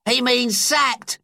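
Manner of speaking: high, slow, gleeful schoolboy; cheerful cry. A narrator's reading. Wayne calling out to the class in a high cheerful voice. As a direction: relish the bad news like a cheeky boy. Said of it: "cried Wayne Drain cheerfully".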